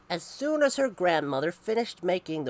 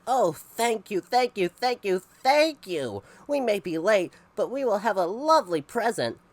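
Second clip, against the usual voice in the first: excited thanks; 6 semitones higher, 7 dB louder than usual. Acted. Grateful family thanks Jillian.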